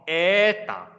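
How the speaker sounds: Ada with a long first vowel, short ta ending; stress demonstration. Shown as a demonstration. Teacher modelling a Russian word, Ada stressed first. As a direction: stretch the first syllable of Ada, clip the last. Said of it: "就要讀重啲嘅".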